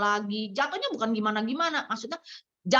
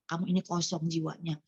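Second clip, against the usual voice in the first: quiet repeat; 8 semitones lower, 7 dB quieter than usual. Shown as a demonstration. Repeating God's words to her spiritual daughter, softer and lower. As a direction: lower and softer, letting it sink in. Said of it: "saya bilang ya".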